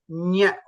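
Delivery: "Nya" pressed from the palate; palatal nasal. Shown as a demonstration. Teacher letting student feel the pressure, palatal "Nya". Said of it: "feel the pressure here".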